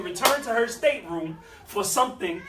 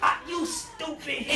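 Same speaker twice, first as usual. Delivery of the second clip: mock insult; 6 semitones higher, 4 dB quieter, slower than usual. Back to arguing with husband.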